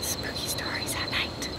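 spooky whisper; breathy hushed storyteller whisper. Real, not acted. Hushed spooky bedtime aside.

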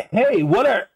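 acted high-pitched cut-off objection; startled protest. Game master voicing a man grabbed mid-call, startled high-pitched protest. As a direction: pitch jumps up, then break off mid-question.

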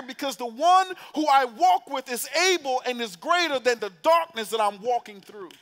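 loud, high preacher fervor; preaching at full force. Acted. Preacher declaiming the psalm to the church.

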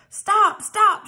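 high, loud shouted stop. A narrator's reading. Thorin shouting at the dwarfs to stop, loud and high. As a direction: bellow it as the dwarf leader. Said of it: "shouted Thorin".

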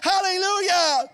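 loud, high, ringing sermon voice; fervent revival preaching; full-force preaching. Acted. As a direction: preach it out to the whole church.

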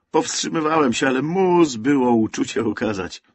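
loud, high, gushing apology; exuberant excuse. A narrator's reading. Man explains tumbling onto Antek, exuberantly joyful. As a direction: burst out with overflowing good humour.

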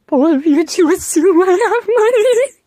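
high, loud laughing retort. Acted. Peter laughing off the fake robbery, high and loud.

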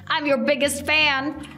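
usual speaking voice

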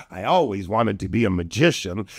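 ordinary delivery, nothing marked

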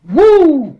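loud high whoop of triumph. Real, not acted. Host cheering himself after his rant, loud high whoop. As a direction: cheer out a big high woo.

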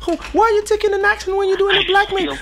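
high, loud, fast falsetto indignation; mock offended complainer. Mocking a man offended by a greeting, high, loud and fast. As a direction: rant it in a squeaky mock-outraged voice.

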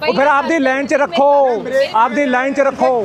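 high, heated shouting at a driver; real angry roadside argument; angry yelling. Real, not acted. Roadside quarrel with truck driver.

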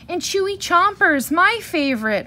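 high, loud cub whine; whining. A narrator's reading. Brother bear begging Mama for candy, whining. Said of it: "whined Brother".

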